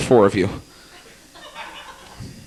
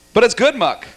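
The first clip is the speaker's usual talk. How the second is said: ordinary talk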